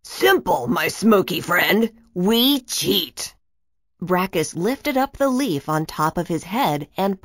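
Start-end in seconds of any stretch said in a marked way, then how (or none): 0.0-3.3 sly villain voice. A narrator's reading. Brackus scheming villain.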